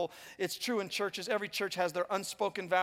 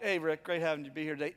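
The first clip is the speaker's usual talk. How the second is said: acting cool; 6 semitones lower, 3 dB quieter, faster than usual. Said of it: "I'm kinda acting cool".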